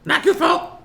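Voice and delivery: very high, loud squeaky character voice; Goo. Acted. Player's character reacts to the fallen enemy, high loud squeal.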